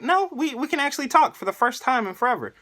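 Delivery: high-pitched, fast retold Mom; hammed-up mother figure, quick and high; mock Mom voice. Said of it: "She's like".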